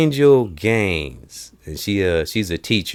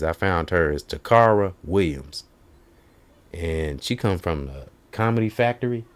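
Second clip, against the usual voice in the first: ordinary talk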